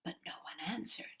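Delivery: quick, whispered and soft; hushed. A narrator's reading. Narrator telling the children that nobody answered, in a whisper. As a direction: drop to a whisper for the empty silence. Silence after the call.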